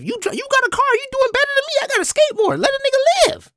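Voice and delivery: very high, exasperated mock rant; retold pissed-off complaint. Skateboarder griping at the driver, comic indignation. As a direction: plead your case in a squeaky, indignant pitch. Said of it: "I'm pissed".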